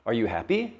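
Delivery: "happy" lifting up at the end; question rise. Shown as a demonstration. Teacher shows how a question changes stress, rising on "happy". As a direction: ask it, letting "happy" climb. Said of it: "Goes up at the end".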